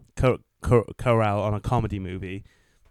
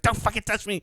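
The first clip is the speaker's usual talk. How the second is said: higher mock snap at his wife; 9 semitones higher than usual. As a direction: play it like a wounded sulk. Retells snapping at his wife.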